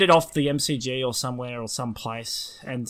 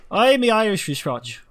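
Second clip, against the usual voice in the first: higher, hammed-up Irish lilt; put-on Irish accent; 6 semitones higher, 4 dB louder than usual. Shown as a demonstration. Friend trying a tongue twister, in mock Irish accent. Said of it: "If I put on an accent".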